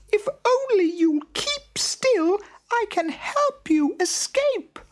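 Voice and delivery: very high, tiny squeaking mouse voice; squeaky mouse. A narrator's reading. Little mouse pleads with the trapped lion, high squeak. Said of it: "he squeaked".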